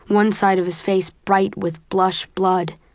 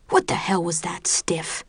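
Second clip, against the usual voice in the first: a cold whispered rebuke, not raised; harsh whisper; 4 dB quieter than usual. A narrator's reading. Instructor scolding a failed trainee, a hissed whisper.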